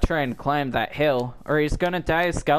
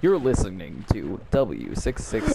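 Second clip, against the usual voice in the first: DJ voice; 7 semitones lower than usual. Retold or imitated. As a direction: lower mock radio host. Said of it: "He sounds like he should be saying".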